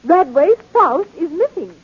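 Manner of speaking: high, alarmed outburst; distraught. Acted. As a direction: build in alarm at the theft. Librarian discovers valuable book stolen.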